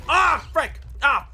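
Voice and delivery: sharp loud cry of annoyance at being hit; frustrated yelp. Real, not acted. Gamer hit by a fireball, yelping in frustration. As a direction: yelp it out, stung.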